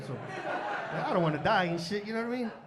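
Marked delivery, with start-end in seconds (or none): none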